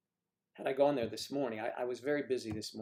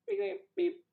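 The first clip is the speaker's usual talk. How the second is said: very high imitated TV beeps; nose-wiggle sound effect, very high; high-pitched magic beeps; 4 dB louder than usual. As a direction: squeak out tiny magical beeps.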